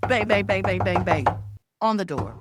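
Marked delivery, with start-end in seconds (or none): 0.1-1.3 loud, rapid-fire bangs; loud banging imitation. Imitating someone banging on the door, loud. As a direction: pound the words out like a fist on a door.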